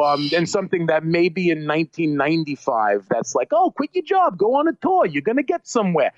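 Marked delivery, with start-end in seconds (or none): 3.5-6.1 high-pitched, eager pep talk in jest; breezy high-voiced imitation of hopeful advice; mock encouragement. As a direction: act out naive cheerleading, pitched way up. Imagined upbeat career advice, acted out.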